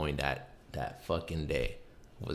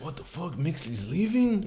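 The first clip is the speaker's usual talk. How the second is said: shocked disbelief; 11 semitones higher than usual. Retold or imitated. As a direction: drag out the shock of the news. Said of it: "People kept telling me".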